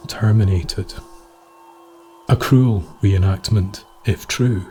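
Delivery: ordinary delivery, nothing marked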